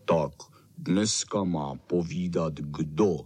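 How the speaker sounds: low, slow muffled dog; Bernardín mumbling. Acted. Bernardín asks who tells today's story, mumbling low. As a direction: mumble it deep and unhurried. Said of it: "Huhlal Bernardín".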